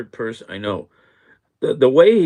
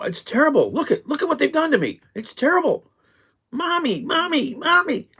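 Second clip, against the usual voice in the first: put-on high whimpering child calling mommy; much higher, whine like a spoiled kid; Trump as whiny child. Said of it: "eight-year-old who's not getting their way".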